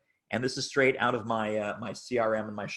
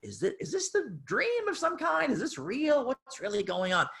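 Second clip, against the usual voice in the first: high, hushed, wondering voice of someone doubting what he sees; dazed disbelief; 12 semitones higher than usual. Retold or imitated. Staring at his bank balance each morning, high dazed wonder. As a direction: ask it up high as if half-dreaming. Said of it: "I would just stare at it and go".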